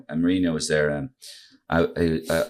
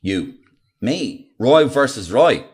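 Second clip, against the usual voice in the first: retold incredulous questions, building to louder; disbelief; 4 dB louder, much slower than usual. Comic hears he is to meet his target, incredulous. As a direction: query each word, then swoop on the last phrase.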